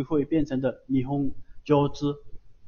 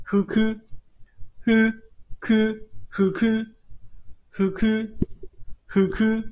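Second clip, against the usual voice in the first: 呼哭 read upward, higher voice; rising pitch accent; 6 semitones higher than usual. Shown as a demonstration. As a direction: step up from 呼 to 哭. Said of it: "往上读哈".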